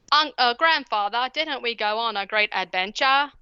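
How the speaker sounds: mock British girls, sweetly overdone; over-the-top British accent. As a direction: ham up a British child's plea. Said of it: "over-the-top British accents".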